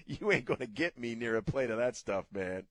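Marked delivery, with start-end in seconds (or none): none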